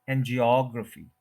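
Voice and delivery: "एंजियोग्राफी" as an-jee-OG-ruh-fee; correct pronunciation. Shown as a demonstration. Teacher giving the English form, third-syllable stress. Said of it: "तो वो ये word है".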